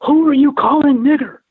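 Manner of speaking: high, loud challenge screamed out; retold scream. Marine confronting a big man in a crowded bar, screaming. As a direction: scream it as an outraged accusation, voice shooting up. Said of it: "And I screamed".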